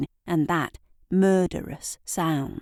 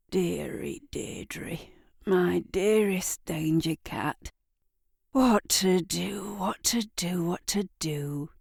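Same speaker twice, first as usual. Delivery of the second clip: cooing to the cat; 3 dB quieter than usual. Acted. Eric softly crooning to his cat Deirdre. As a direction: croon it like a lullaby.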